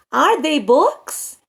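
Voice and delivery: slow "Are they books" lifting at the end; deliberate model question, "books" left rising; question intonation. Shown as a demonstration. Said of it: "além da minha entonação".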